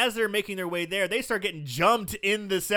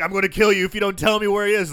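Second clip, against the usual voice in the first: louder fast hammed-up threat; mock threat; 4 semitones higher, 9 dB louder, faster than usual. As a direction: rattle off the threat.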